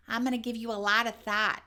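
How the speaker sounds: loud, overdone fake Chicago accent; mock a big Chicago accent, "thought" like "that"; exaggerated, mistaken Chicago vowels; botched Chicago accent. Shown as a demonstration. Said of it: "Everything becomes this really big".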